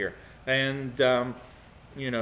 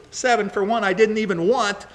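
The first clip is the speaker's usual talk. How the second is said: raised, louder protest; indignant retort; 6 semitones higher, 5 dB louder than usual. Acted. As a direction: toss it in as an aggrieved jab. Imagined retort in [name]'s tirade.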